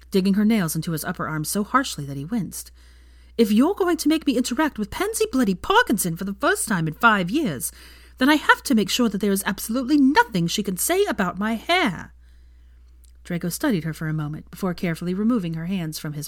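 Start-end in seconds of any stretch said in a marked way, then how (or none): 3.4-12.1 higher, urgent worried voice; fretting Hermione. A narrator's reading. Hermione frets to Draco about Pansy, anxious. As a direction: rush it out in a fret.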